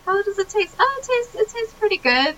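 playful character voices. Retold or imitated. Characters sipping molten silver and tasting scones, high playful voicing. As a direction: play the characters sweetly over their odd snack.

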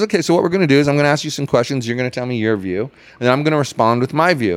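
rattled off quickly, matter-of-fact; fast quoted explanation. Retold or imitated. Host quickly laying out rules to protester, fast and brisk. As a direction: run through the plan briskly. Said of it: "He goes".

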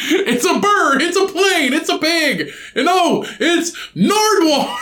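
very high, excited It's a bird, it's a plane; hammed-up high superhero announcement; mock superhero announcer. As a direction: squeal the Superman line, slowing on the reveal.

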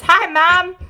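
put-on little girl voice. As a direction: chirp it like a small daughter bursting in. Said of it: "she comes in with her little piggy tails".

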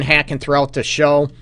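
ordinary delivery

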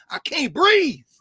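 high, loud, anguished cry of the words. Acted. Preaching to the room, a loud anguished cry. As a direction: cry it out like a dying plea.

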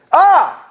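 sudden high, loud "Ah!"; loud high-pitched yell to scare someone; startling yell. Retold or imitated. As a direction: jump out with a kid's boo-style yell. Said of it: "he yells at me".

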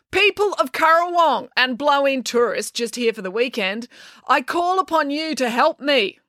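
high, slow, declamatory speech; grand public appeal. Acted. April standing on the table to rally patrons, declamatory. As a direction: proclaim it to the whole room. April addresses the whole cafe.

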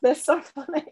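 normal talking voice

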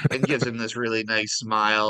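ordinary talk

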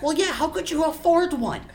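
high-pitched goblin retort; goblin yelling back. Acted. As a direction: squeal it back mid-squabble. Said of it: "the other one yells back".

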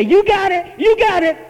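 high loud hammed-up cheer; mock overzealous shout. Preacher mimicking a garlic-breathed brother shaking him, loud. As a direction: yell it while shaking someone.

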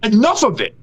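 fed up, letting it all out, pitched high; furious outburst. Real, not acted. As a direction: blow up at the whiners. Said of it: "Breathe. Breathe".

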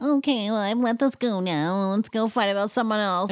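mock Erica. Mocking Erica dismissing.

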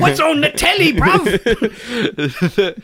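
high, laughing Cockney voice; cheeky London bloke asking; British accent. Pretend. British character, high pitch.

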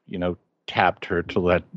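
ordinary delivery

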